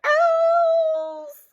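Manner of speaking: slow, very high, loud yell; Elephant's big shout; really loud. Acted. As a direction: bellow it out. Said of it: "Really loud".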